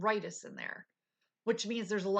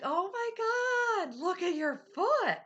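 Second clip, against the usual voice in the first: much higher and louder, slow and drawn out; amazed husband; 12 semitones higher, 6 dB louder, slower than usual. Retold or imitated. Husband seeing his wife's foot straight again, amazed cry. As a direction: play the stunned husband, crying out in amazement.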